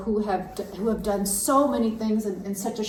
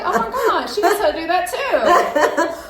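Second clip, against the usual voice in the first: high, loud amazed exclamation with a laugh; amazed gush; 9 semitones higher, 7 dB louder than usual. Retold or imitated. Host retelling her amazement at her guest, loud and high. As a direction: gush like you can't believe she does it all. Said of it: "I'm like".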